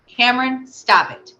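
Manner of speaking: put-on loud, insistent command to a child; stern order. Mother telling her son to speak, stern and loud. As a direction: order him firmly, volume up.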